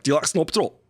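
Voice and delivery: light mock Scottish accent. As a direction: tease a Scottish friend in a hint of Scottish accent. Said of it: "get them on the phone and be like".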